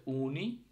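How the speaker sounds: "উনি" starting with a long উ; teacher's careful "উনি", U as উ; Finnish U sound. Shown as a demonstration. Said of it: "ইউটা কিন্তু উর মতো উচ্চারণ হচ্ছে".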